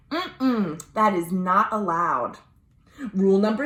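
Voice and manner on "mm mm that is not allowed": plain reading voice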